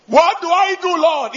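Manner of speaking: high, loud desperate plea; anxious cry to God. Retold or imitated. The man, frightened for his baby, pleading loudly with the Lord. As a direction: call out to the Lord in alarm.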